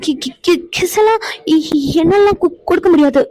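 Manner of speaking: stumbling over every word, high and shy; flustered stammer. Acted. Wife stammers when husband asks for a kiss, flustered and high. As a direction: get tangled in it, too embarrassed to say kiss.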